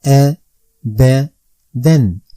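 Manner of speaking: "a", "b", "den" each said alone as separate Arabic syllables. Shown as a demonstration. Teacher spelling a Quranic word for pupils, syllable by syllable. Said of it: "I say".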